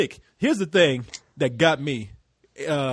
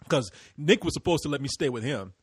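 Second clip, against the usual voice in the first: ordinary delivery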